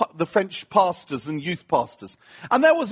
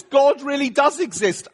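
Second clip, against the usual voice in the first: excited awe; 7 semitones higher than usual. Retold or imitated. Preacher reliving his amazement at God, excited. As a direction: blurt it out in wonder. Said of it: "I was like".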